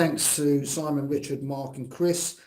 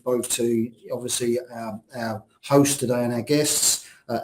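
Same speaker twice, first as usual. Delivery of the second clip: usual speaking voice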